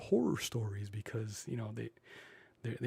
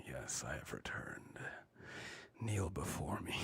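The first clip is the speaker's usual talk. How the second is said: low whispered regal king voice; hammed-up royal whisper, much lower; Sleep King; 7 semitones lower, 6 dB quieter than usual. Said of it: "I am the Sleep King".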